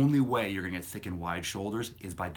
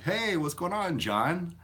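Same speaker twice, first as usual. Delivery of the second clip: high, bright mock hello; mock friendly greeting; 10 semitones higher, 4 dB louder, slower than usual. To a follower on Instagram, high mock-friendly hello. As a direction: greet him like an old buddy, hammed up.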